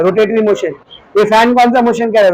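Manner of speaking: ordinary talk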